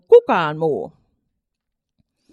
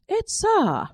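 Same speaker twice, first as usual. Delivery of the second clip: mother's refusal; 14 semitones higher than usual. A narrator's reading.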